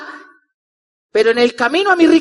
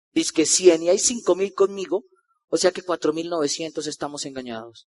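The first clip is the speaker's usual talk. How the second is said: sceptical mutter; 8 semitones lower, 9 dB quieter than usual. Retold or imitated. Sceptical inner thought.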